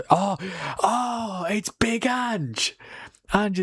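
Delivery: rising excited squeal at spotting him. Real, not acted. Streamer spotting Ange on the World Cup show, thrilled. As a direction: shoot up high, delighted to see him.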